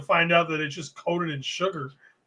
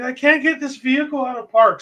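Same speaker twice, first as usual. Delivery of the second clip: caller; 7 semitones higher, 6 dB louder than usual. Retold or imitated.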